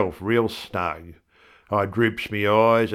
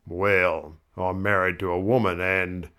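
plain reading voice

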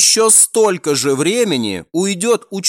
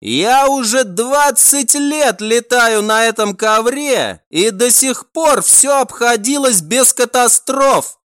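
shouting over wind; 6 semitones higher than usual. A narrator's reading. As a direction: call out boldly over the wind. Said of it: "стараясь перекричать ветер".

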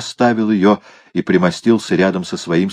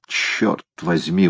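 plain reading voice

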